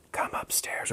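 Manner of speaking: low whisper imitating a movie line; hushed, whispery retelling; whispered movie quote. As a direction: whisper it softly, as if from the film. Acting out the movie's voices.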